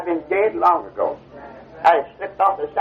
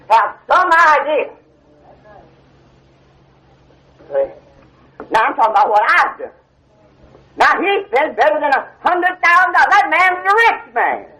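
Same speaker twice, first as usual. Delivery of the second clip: high, louder preaching voice, pressing the point; fervent preaching; 7 semitones higher, 4 dB louder than usual. Acted. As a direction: preach it from the heart, climbing. Preacher swelling again.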